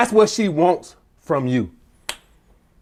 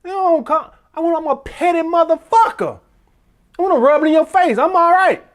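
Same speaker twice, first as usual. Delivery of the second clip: comic guy impression; 10 semitones higher than usual. Guy explaining he is petty, high comic voice. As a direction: put on a high, petty protesting voice. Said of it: "how's that guy say".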